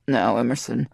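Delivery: a low, firm demand; low command. A narrator's reading.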